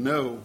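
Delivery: ordinary talk, nothing marked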